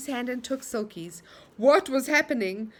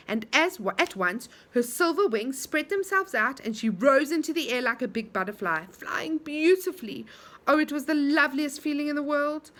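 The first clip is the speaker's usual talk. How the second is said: usual speaking voice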